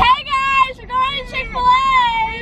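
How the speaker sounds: high, drawn-out vlogger voice. Acted. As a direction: stretch it like a YouTuber. Vlog intro, put-on voice.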